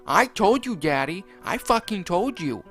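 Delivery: high, angry spat rant; Jeffy spitting it out. Acted. Jeffy blames Mario after killing Rosalina, high and angry. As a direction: spit the blame at him. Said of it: "Jeffy spat".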